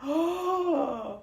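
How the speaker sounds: long high intake of breath; amazed gasp. Real, not acted. Vlogger stares at her rare card, awestruck gasp. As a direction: gasp in real astonishment.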